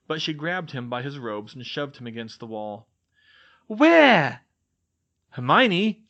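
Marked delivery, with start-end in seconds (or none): 3.7-4.4 very loud, high snapped demand; angry demand. Acted. Shoves him against the wall. 5.3-6.0 loud, high call. Acted. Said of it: "Ron called".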